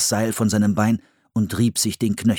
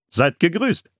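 a touch of a genial elder, pitched up; friendly settler; 8 semitones higher than usual. A narrator's reading. Pilgrim stepping forward to strangers, friendly greeting. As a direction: greet strangers openly and politely. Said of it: "sagte der freundlich aussehende Mann".

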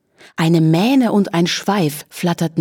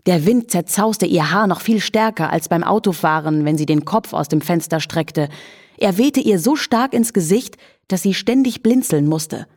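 ordinary delivery, nothing marked

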